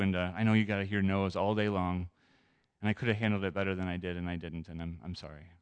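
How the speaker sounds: low, subdued apology; sincere apology. Retold or imitated. Man apologizes to door-to-door salespeople he brushed off, sincere.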